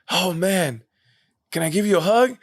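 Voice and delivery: high-pitched imitation of an awed fan; starstruck fan. Husband asks his hero for a hug, starstruck. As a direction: plead like a thrilled admirer.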